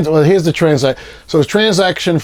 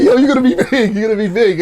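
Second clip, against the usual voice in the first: laughing hype; 9 semitones higher, 4 dB louder, faster than usual. Retold or imitated. Friend hyping him up, laughing.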